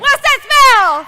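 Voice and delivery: slow, high, loud cheer call; cheerleader shout. Acted. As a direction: drag it out like a cheer. Leading a cheer chant.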